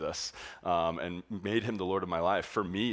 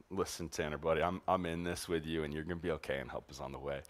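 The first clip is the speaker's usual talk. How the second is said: softer, lower comforting words; tender reassurance; 4 semitones lower, 7 dB quieter than usual. Retold or imitated. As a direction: quietly soothe a scared kid. Comforting sick kid with arm around.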